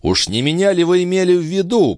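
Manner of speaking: high-pitched affronted question, settling at the end; indignant. A narrator's reading. Balsamo affronted by the police chief's hint, indignant. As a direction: take offence, starting high. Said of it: "возмутился Бальзамо".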